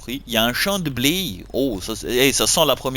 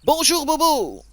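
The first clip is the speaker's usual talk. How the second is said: high, slow, sing-song mail greeting; game mail read in a cheery high voice; put-on mail voice; 13 semitones higher than usual. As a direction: play the game's cheery mail voice. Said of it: "je vais vous la faire à chaque mail".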